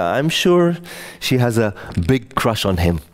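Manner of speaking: ordinary delivery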